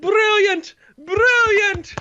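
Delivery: high shouted praise; delighted cheer. Acted. Crowd applauding the show, shouted cheer. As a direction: cheer it from the stands.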